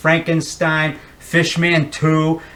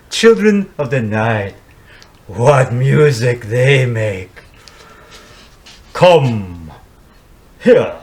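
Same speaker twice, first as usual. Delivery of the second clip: slow, lower, drawn-out Count; Bela Lugosi impression; 3 semitones lower, slower than usual. Dracula welcoming Van Helsing, slow and lower. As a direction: savour each word like the old Dracula film. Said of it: "how about Bela Lugosi?".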